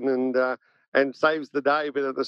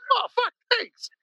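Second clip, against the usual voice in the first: huge relief. Retold or imitated. Patient finally told what is wrong, hugely relieved. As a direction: pitch leaping high in retold relief. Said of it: "I was more relieved".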